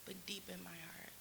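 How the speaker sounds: hushed, breathy secret whisper. Retold or imitated. Comic telling the crowd her hidden thoughts, whispered. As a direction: whisper it like a confession. Inner thought set against polite words.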